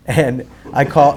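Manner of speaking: ordinary talk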